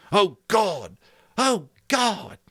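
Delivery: high, loud agonized cry; anguished cry. A narrator's reading. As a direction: cry out torn by indecision. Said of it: "Ralph cried out".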